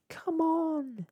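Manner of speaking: very high, loud cheer; cheering shout. A narrator's reading. Nuts on the ship urging the rower on, high and loud. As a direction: urge him on from the deck. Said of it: "the nuts on the ship shouted".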